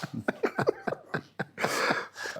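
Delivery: airy, high wheezing laugh; breathless laugh. Real, not acted. Teammate's joke about Messi.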